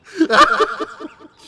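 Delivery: shrieking laughter; loud high shriek of laughter. Real, not acted. Reader losing it over the fanfic, shrieking with laughter. Cracks up.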